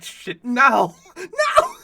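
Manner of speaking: loud, high outburst of protest; laughing shout. Real, not acted. Friend realizes the old-folks joke is true, shouting in laughing protest. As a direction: yell it out, cracking up. Laughing outcry at grandma candy remark.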